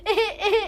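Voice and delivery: mock sobbing. Elder sister-in-law mocks the bride's tears with fake sobs. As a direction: imitate her crying as sniffling sobs. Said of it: "એહે કરીને પીલુડા પાડવા".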